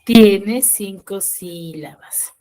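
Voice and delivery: slow and emphatic. Shown as a demonstration. Teacher confirming the count to her online class, slow and emphatic. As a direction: lay it out slowly so the class can follow. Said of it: "¿cómo pronuncio yo comunicación?".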